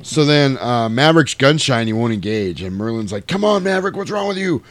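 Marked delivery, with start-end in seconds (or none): 3.3-4.6 yelling at the pilot to get in there; mock yell. Radar officer yelling at Maverick to engage, high urgent shout.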